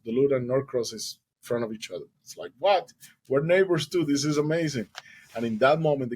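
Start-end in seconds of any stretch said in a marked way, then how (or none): 2.6-4.9 high, loud delighted surprise; amazed. Retold or imitated. Strangers discover they are neighbors, amazed. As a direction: burst out in happy disbelief.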